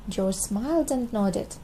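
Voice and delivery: usual speaking voice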